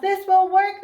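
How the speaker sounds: high and loud, proudly declared; triumphant declaration; proud announcement. Acted. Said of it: "they announced".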